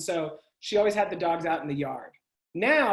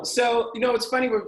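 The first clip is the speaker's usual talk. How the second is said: normal talking voice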